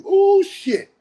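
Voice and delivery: high, loud ooh of amazement; a real startled ooh at the punchline; high and loud exclamation; surprised exclaim. Real, not acted. Reacting to uncle's lesson.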